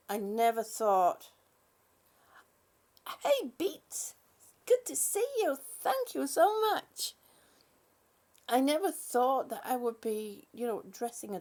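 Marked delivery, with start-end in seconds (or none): 3.2-7.1 mock sweet greeting, higher, slowing down. Owner imagining a greeting she never expected, in a light high voice.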